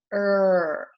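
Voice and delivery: a drawn-out "er", the R sound alone; bossy R sound. Shown as a demonstration. Teacher sounding out "er" for the class. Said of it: "it has that bossy R sound".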